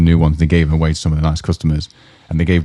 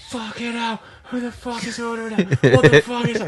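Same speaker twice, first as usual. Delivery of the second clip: high, hushed mock yelling from afar; imitated angry swearing; 4 dB quieter than usual. Angry cook swearing from the kitchen, imitated distant yelling. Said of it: "you'd hear swearing from the kitchen".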